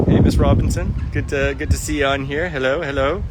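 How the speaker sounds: bright, loud hello to a viewer; excited greeting. Real, not acted. Greeting a viewer who joined.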